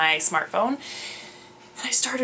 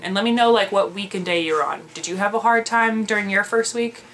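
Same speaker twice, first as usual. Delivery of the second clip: ordinary talk, nothing marked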